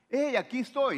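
playful mock greeting, pitched high; mock announcement. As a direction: call it out like a showman popping in. Mocking a God who announces himself.

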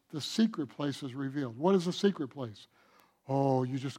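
almost hush the reveal. Real, not acted. Preacher hushes on revelation.

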